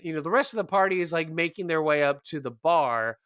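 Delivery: usual speaking voice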